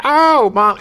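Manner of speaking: put-on gleeful high kid voice; delighted kids. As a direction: squeal it like happy kids. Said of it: "we were just like".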